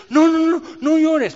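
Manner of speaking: high, loud frantic hushing; mock hushing. Christians rushing to stop a griever crying, loud frantic hushing. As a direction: shoo the tears away in a panic. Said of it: "los cristianos creen".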